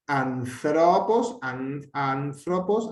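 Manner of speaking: slow ANTHROPOS, accented on the first syllable AN; antepenultimate Greek accent. Shown as a demonstration. Teacher showing accent position, slow first-syllable accent on ANTHROPOS. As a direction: draw out ANTHROPOS syllable by syllable, leaning on AN. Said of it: "está en la antepenúltima".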